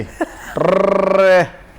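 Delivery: trilled rrr flourish; rolled r. Real, not acted. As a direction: roll the r long and playful. Poet's playful rolled-r flourish. (Rolled र की लंबी गूंज)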